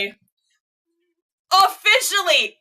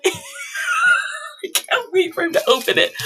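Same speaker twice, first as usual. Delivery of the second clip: laughing; 13 semitones higher than usual. Real, not acted. Laughing excitement.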